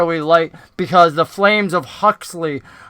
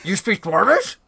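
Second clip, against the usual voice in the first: dumbfounded; 3 semitones higher than usual. Acted. Dwarf leader to a goblin speaking Dwarvish, dumbfounded. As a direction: question rising sharply in disbelief. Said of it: "like, dumbfounded".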